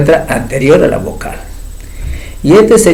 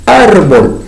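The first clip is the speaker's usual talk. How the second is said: Árbol split into two syllables, ár-bol, first one louder; syllabified demo; 3 semitones higher, 3 dB louder than usual. Shown as a demonstration. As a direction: tap out Árbol in two beats. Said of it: "dos sílabas". (Árbol separado en dos sílabas)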